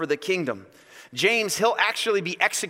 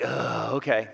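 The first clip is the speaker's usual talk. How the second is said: low, weary sigh of agreement; resigned, lower-pitched mock patience; eye-rolling exasperation; 7 semitones lower than usual. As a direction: give in with an audible eye roll. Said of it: "the best eye roll from Jesus".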